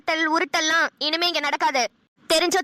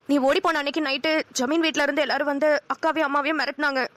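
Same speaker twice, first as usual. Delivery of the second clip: ordinary talk, nothing marked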